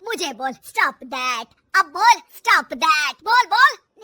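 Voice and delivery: loud, menacing challenge; forceful mother-in-law taunting; angry goading. Acted. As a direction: build to a shouted dare. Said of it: "गुस्सा आ जाता है".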